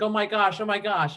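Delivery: imitating crying sister. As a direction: play the panicked tearful sister lightly. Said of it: "my sister started to cry".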